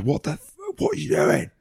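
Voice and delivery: fly off the handle at the passer; going mad. Retold or imitated. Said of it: "booted the ball going mad".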